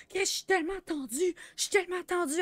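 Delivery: mock Sam whining that he's tense. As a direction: whine it like an anxious guy. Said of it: "Il est comme".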